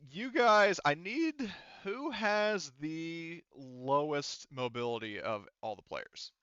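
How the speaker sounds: normal talking voice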